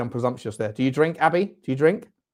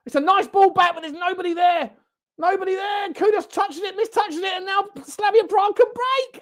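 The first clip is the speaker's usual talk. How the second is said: much higher, racing commentator call; excited play-by-play; 5 dB louder than usual. Acted. Streamer commentating the counterattack for viewers, excited play-by-play. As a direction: call the attack like a live commentator.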